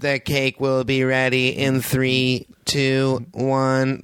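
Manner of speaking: emotionless countdown, slow and flat; monotone voice. Retold or imitated. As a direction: read it like a bored robot child. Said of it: "just the same monotone".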